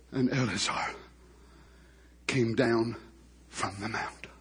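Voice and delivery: low, hushed and halting; solemn scripture telling. A narrator's reading. Preacher recounting Aaron's death to the congregation, hushed and solemn. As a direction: tell it gravely, softly, with long pauses.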